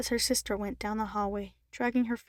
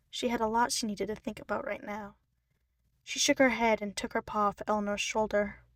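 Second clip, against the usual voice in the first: ordinary delivery, nothing marked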